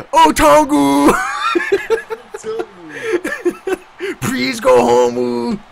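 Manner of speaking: drawn-out teasing plea; slow mocking plea. Real, not acted. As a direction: drag it out, teasing.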